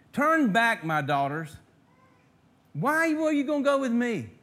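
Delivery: loud, high mocking plea with a sarcastic edge; bitter old widow mocking her daughters-in-law. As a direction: throw the question back with biting sarcasm. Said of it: "You hear the sarcasm?".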